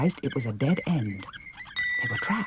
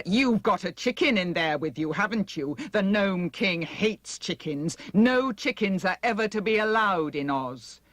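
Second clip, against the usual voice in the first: the head Wheeler taunting Dorothy through the door; menacing Wheeler; 6 semitones higher than usual. A narrator's reading. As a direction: lord it over the girl.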